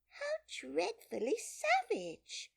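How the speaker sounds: very high, drawn-out dismay; shocked exclamation. A narrator's reading. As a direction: exclaim it slowly, aghast. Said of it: "exclaimed Alice".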